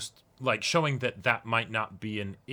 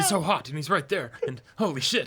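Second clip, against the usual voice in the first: higher, rushed fangirl panic; giddy panicked gush; 6 semitones higher, 3 dB louder than usual. Retold or imitated. Co-host voices Haruki flustered by his hot crush, giddy panic. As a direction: babble like a smitten teen losing it. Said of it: "And then he's just like".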